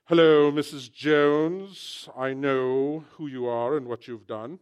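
put-on slow, loud English accent. English drama character confronting Missus Jones, slow English accent. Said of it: "Something about that English accent".

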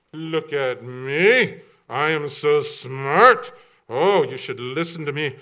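slow, loud, hammed-up show-off; boasting braggart. Preacher mocking braggarts, slow hammed-up boasting. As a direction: strut it like a pompous know-it-all. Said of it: "They were braggarts".

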